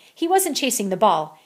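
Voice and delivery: ordinary delivery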